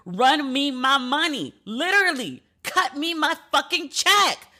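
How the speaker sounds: high, slow, furious demand; livid demand. Real, not acted. Demanding the bank release her money, high and slow with fury. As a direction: spell it out to the bank, seething. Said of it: "Can you hear that I'm livid?".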